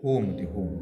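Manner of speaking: teacher modelling होम; low careful model word. Shown as a demonstration. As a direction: settle down on होम. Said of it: "हे काय होते".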